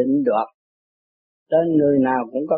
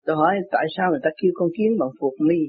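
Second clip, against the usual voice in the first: ordinary talk, nothing marked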